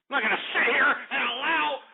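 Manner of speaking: yelling, pitch way up, to throw the caller off the show; enraged host shouting at the engineer; furious shout. Acted. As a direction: roar to get him off the air. Host erupts at prank caller.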